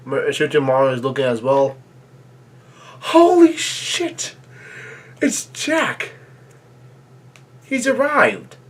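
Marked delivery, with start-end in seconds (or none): 3.0-8.6 very high, drawn-out fanboy squeal; thrilled shock. Real, not acted. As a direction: gasp it out high, stretching the words in disbelief. Thrilled reaction to character's return.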